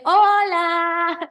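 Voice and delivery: high, loud, cheery hello; a bright shout of hello; joyful greeting. Pretend. Pretend Fercho greeting her in heaven, loud and high.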